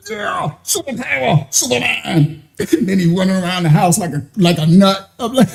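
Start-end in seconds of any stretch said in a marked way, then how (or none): 0.1-2.4 put-on higher, cheering the boy; playful hype for the kid; hyping up.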